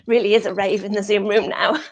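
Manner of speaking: quick quip breaking into a real laugh; amused, laughing. Real, not acted. Teacher joking about the Zoom class dancing, laughing. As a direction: toss it out fast and laugh.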